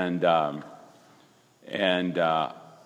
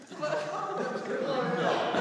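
real laugh at the memory; a quick high laugh; high burst of laughter; 5 dB quieter than usual. Real, not acted.

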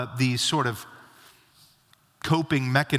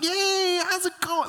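much higher, bright fake-cheerful hello; powered-up greeting. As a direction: pump it up like a pastor greeting a church member in the store. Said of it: "Like, totally power up".

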